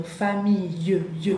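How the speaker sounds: "famille" slowly, ending in a clear "ye" sound. Shown as a demonstration. Teacher asks students to listen, "famille" sounded out slowly with "ye". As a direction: draw out "famille" to show the final y-glide. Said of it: "escuchen el sonido".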